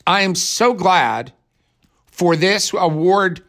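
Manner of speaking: high, slow, stilted fake laugh and thanks; a hammed-up interpreter voicing a winner's joy; mock interpreter laugh. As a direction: mimic a clumsy interpreter laughing woodenly. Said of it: "they laugh and they're like".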